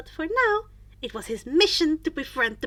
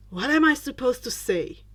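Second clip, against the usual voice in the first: usual speaking voice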